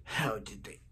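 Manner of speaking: low, hushed villain voice; deep soft sinister figure; Zoom voice. Acted. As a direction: question them in a soft, low menace. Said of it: "I do a very good Zoom voice".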